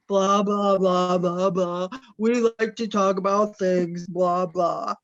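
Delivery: loud, droning grown-up imitation; mocking boring adults. Child complains to Jeremy about dull adults, mocking their talk. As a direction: mimic tedious adult chatter, heavy and loud. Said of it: "It's just adults going".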